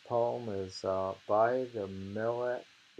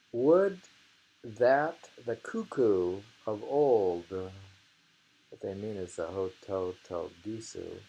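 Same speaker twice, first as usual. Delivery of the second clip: loud, slow, declaimed haiku; dramatic poem reading; 6 dB louder, slower than usual. Acted. Reading the cuckoo haiku aloud, loud and slow.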